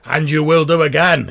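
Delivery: big, loud in-character vow; emphatic declaration. Acted. In-character boast to companions.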